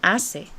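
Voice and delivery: hace with the C said as "s"; S sound. Shown as a demonstration. Teacher explaining C before E, hace with an S sound. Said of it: "it's going to sound more like an S".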